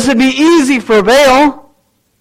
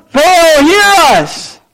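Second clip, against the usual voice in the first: high pleading shout; mock yell; 6 semitones higher, much slower than usual. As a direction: call out to a deaf god, pleading.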